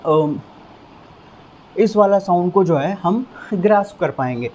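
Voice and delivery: ordinary delivery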